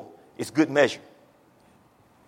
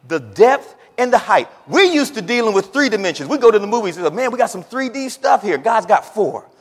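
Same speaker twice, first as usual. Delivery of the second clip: higher, punched delivery; emphatic preaching; 8 semitones higher, 5 dB louder than usual. Real, not acted. Preacher telling congregation God exceeds three dimensions, higher and emphatic. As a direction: punch the contrast out to the room.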